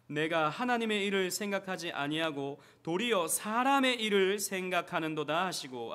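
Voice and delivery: loud, slow, solemn recitation; scripture reading. Acted. Pastor reading Matthew aloud with the congregation, solemn recitation. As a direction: proclaim the verse to the church.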